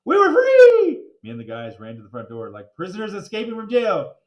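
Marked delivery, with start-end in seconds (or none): 0.1-1.0 high, loud cry of freedom; joyful shout. A narrator's reading. Kids escaping school, loud joyful shout. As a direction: burst out with joy. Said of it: "school's out".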